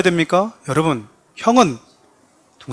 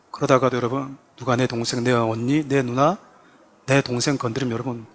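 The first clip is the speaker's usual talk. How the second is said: normal talking voice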